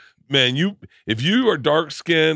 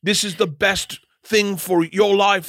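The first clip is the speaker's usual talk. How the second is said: mock Australian accent; 6 semitones higher, slower than usual. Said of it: "he got an Austra-, Australian accent".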